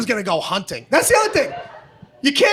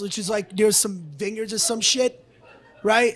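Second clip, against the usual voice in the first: ordinary talk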